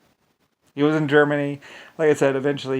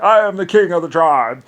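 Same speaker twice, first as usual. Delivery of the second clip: louder mock boast; mock chieftain; 5 semitones higher, 11 dB louder than usual. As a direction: puff up like a pompous king. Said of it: "He was like".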